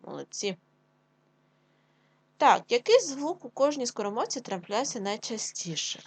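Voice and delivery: usual speaking voice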